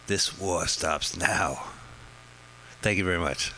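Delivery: slow, lightly voiced Brando mumble; a hint of the Godfather, dragged out; Marlon Brando impression. Quoting the movie line, a lightly voiced Brando. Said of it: "do an impression of Marlon Brando".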